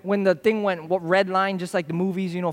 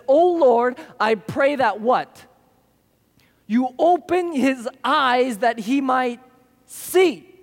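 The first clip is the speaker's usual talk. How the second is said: fervent prayer; 4 semitones higher, 4 dB louder, much slower than usual. Retold or imitated. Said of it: "Elisha prayed and said".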